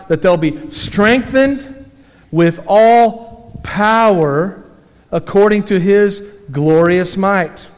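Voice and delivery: slow, deliberate reading of the verse; slow scripture reading. Shown as a demonstration. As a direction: draw out each phrase of the verse.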